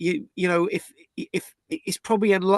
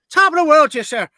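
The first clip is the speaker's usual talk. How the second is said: put-on high, loud stage Irish accent; hammed-up Irish accent, cheery and bold; 9 semitones higher, 8 dB louder than usual. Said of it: "so band of Irishmen".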